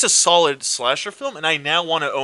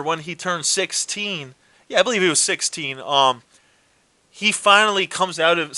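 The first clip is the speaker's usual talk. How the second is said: ordinary talk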